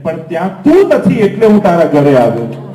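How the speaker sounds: loud, loving voice of God; Thakorji speaking. Acted. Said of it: "Thakorji કે".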